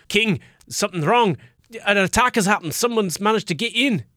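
high-pitched urgent messenger voice; alarmed guard. Acted. Guard rushing in to warn the king, alarmed high voice. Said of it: "one of the guards rushes in".

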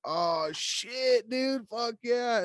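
high, drawn-out mock drunken cheer; mocking excited drunk voice; hammered drunk. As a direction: imitate a drunk teenager whooping slowly. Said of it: "that are hammered drunk".

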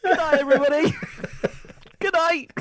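high, loud, through laughter; helpless laughing farewell; laughing sign-off. Real, not acted. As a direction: crack up while signing off. Mock sign-off after a failed item.

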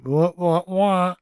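mock "wah-wah" punchline sting; sad trombone imitation.

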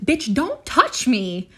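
mock yell, loud and drawn out; retold yell. Telling off the man in the bar, retold yell. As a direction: act out snapping at a stranger, slowly and loudly. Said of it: "yelled at him in the bar".

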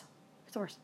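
It gets worse, dropped to a breathy whisper; whispered aside. Shown as a demonstration. Confiding to the audience, a hushed whisper. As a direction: lean in and whisper it like a secret.

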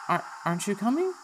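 stumble, then ask anxiously; worried Dora. Acted. Dora asking Kyle, high anxious voice. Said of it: "And she goes".

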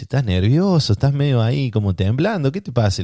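voz más aguda, dulce y cuidadosa; tono amoroso de enfermera. Retold or imitated. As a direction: como una enfermera amorosa que calma. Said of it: "una señora muy amorosa". (enfermera cariñosa, voz aguda y dulce)

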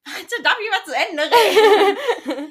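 high, loud and slow through laughter; real laughter over mock indignation; laughing protest. Real, not acted. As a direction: protest while cracking up. Laughing protest at teasing interruptions.

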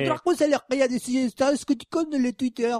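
badly miss a Mexican accent; failed Mexican accent. Host trying to read the Mexican's email, failed accent. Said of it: "Ese tampoco es mexicano".

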